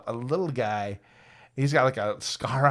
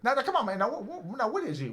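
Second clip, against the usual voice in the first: high hustler voice; 10 semitones higher than usual. Retold or imitated. Little guy voiced questioning.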